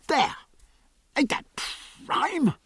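high, delighted exclamations; proud delight. A narrator's reading. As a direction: show it off proudly, pitch leaping up. Jack proudly showing off new floor.

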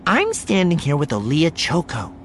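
high, projected TV reporter delivery; news broadcast intro. Acted. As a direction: open the live broadcast with showy polish. Voices reporter's live broadcast opening.

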